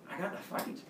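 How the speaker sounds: very soft, small reply; sheepish confession. Retold or imitated. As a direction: confess it under your breath to mom. Sheepish boy confesses to mom.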